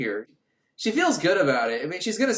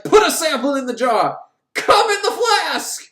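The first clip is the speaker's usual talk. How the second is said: loud yell climbing much higher, slowing at the end; frantic shout; 10 semitones higher, 6 dB louder than usual. Retold or imitated. Doctor yelling orders at the medium, loud frantic shout. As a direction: bellow the order at her. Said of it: "He starts shouting at her".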